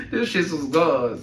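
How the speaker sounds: slow, laughing through the words. Real, not acted. Son laughing about the lost sandals, slowly, through real laughter. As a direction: let the laugh break through.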